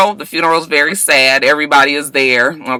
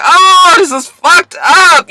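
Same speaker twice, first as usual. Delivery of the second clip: much higher, louder, slow disbelief; shocked outcry; 12 semitones higher, 5 dB louder, slower than usual. Real, not acted. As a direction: let the shock burst out slowly. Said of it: "I just was just like".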